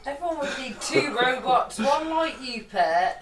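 usual speaking voice

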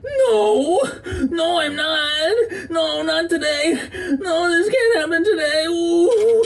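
very high, crying, shouting; panicked crying. Acted. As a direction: wail in desperate panic. Crying panic during accident.

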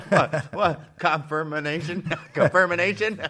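giggling questions; cracking up at a pop-up; laughing. Real, not acted. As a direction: laugh through the words. Laughing at a screen prompt.

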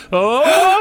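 panicked cry. Acted. Whistle inhaled, panic.

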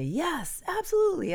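high, bursting yes absolutely; eager email voice. Retold or imitated. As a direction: gush it like an overeager email reply. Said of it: "exclamation points".

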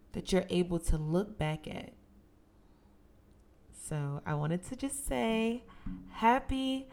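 ordinary delivery

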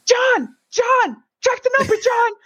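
high, urgent mock shouting to a friend; imitated freak-out. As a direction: yell for backup like a panicking man. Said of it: "He's freaking out".

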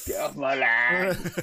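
slow, lightly voiced grumpy farmer; grumpy old farmer. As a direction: drawl it like a cross old landowner. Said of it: "Victor Meldrew stage of life".

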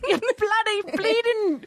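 high, slow, laughing fake Cockney; bad British accent. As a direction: overdo the London swearing. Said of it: "bad attempts at British accents".

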